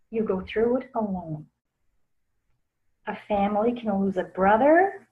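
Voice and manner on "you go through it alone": "alone" dropped very soft; quiet emphatic repeat. Shown as a demonstration. Teaching grief is lonely, a soft repeated lesson point. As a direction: repeat it softer to let "alone" sink in.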